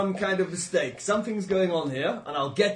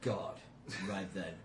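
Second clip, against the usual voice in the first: put-on low, blustering old adventurer; gruff sea-captain bluster, low; 11 semitones lower, 13 dB quieter than usual. Player voicing his captain.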